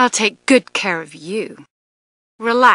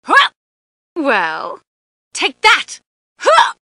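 teasing fighter taunts; 9 semitones higher, 4 dB louder than usual. Acted. Fighting taunts and effort grunts.

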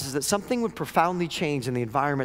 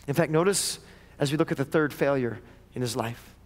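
ordinary delivery

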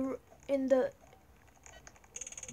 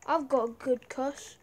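ordinary delivery

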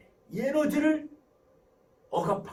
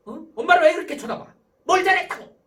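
high, loud mock telling-off; exasperated parent with raised voice; scolding mother; 7 semitones higher, 12 dB louder than usual. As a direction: act a mother challenging her child angrily. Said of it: "막 혼내키죠".

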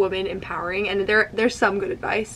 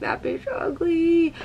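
sassy viewer; 9 semitones higher, much slower than usual. Retold or imitated. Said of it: "you're like".

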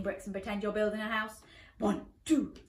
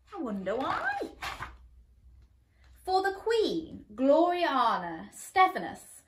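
ordinary delivery, nothing marked

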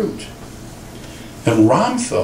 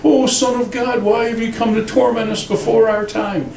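pitched way up, a cringing demon pleading; high, frightened demon crying out; 9 semitones higher than usual. Retold or imitated. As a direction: play the terrified demon begging Jesus. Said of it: "that demon-possessed guy jumped out and said".

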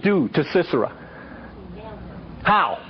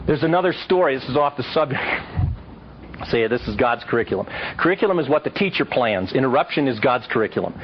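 ordinary talk, nothing marked

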